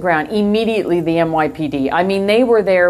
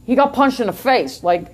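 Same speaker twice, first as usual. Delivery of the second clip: imitated New York witness accent. Witnesses laughing as they tell the officer, New York accent. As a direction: tell it like a New Yorker, dropping the r. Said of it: "in their New York accents".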